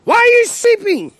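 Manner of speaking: high, loud, harsh cry; harsh demand. Retold or imitated. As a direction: snap it at him in desperation. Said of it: "and, and spoke harshly".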